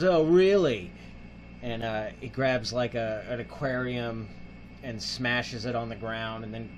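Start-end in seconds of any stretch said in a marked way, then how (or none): 0.0-0.9 louder, higher mocking retort; mock challenge. Hijacker answering the driver's threats, mock challenge. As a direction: throw it back like a cocky hijacker.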